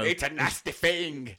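put-on slow, silly cod-accented pontiff; mock Pope. Pope answering the bikini question, slow mock disgust. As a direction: condemn it in a hammed-up foreign accent. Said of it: "Your Holiness".

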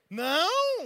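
high, loud incredulous echo; acted indignant outburst. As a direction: throw the word back loudly. Husband's angry reaction to denial.

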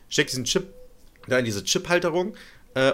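usual speaking voice

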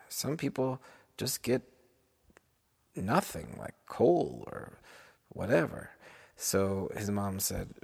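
usual speaking voice

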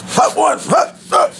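high, punchy quarterback bark; barked signals. Retold or imitated. Host mimicking quarterbacks at the line, barked signals. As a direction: imitate quarterbacks barking at the line. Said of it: "they bark shit".

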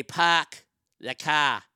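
drawn-out demo of a Boston accent. Shown as a demonstration. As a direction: drop the Rs in "pack" and "car", Boston-style. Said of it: "they don't pronounce an R".

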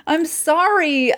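high, slow, pleading apology; drawn-out apology aimed at the author; mock apology. Real, not acted. Host apologizing to Neil Gaiman, slow and high. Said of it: "I'm sorry to our listeners".

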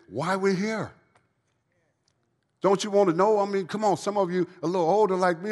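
high, loud, driving preacher's cry; full-force preaching. Real, not acted. Preacher urging people in treatment forward, full-force preaching. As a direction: lift it up and call out to the whole room.

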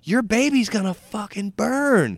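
put-on high, loud old-lady curse; imitated vicious threat. Old lady repeating it to waitress, vicious.

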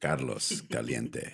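put-on Carlos Caliente in a low, slow Spanish accent. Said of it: "let me do my accent real quick".

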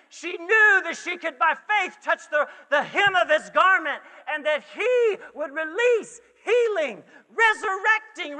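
loud, impassioned sermon with hard-punched key words; full-force preaching. Real, not acted. Preacher telling of the woman's faith, full-force preaching. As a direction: drive it upward like a revival preacher.

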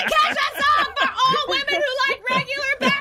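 mock excited shout. Mocking shout.

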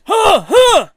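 imitated grunting, high and forceful; two explosive grunts; loud mock grunts. Junker accosting someone picking up cans, loud imitated grunting. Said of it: "Starts grunting at me".

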